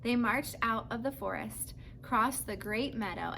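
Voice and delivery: ordinary delivery, nothing marked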